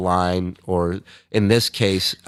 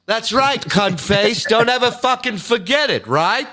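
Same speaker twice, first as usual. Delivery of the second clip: much higher squeaky put-on voice; mock high voice; 11 semitones higher than usual. Answering a fan in a high cartoon voice.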